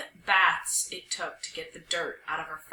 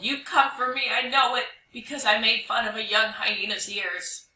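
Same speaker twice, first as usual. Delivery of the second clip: raised, loud, pleading wail; howled confession; 3 semitones higher, 5 dB louder than usual. Acted. Dog confessing to the spirit that came for him, howling. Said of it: "he howled".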